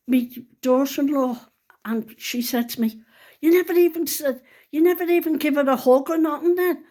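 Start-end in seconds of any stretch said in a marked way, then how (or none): 3.4-6.8 higher-pitched, quick mock reproach; chiding. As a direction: retell an indignant telling-off. Daughter-in-law chiding her in retold exchange.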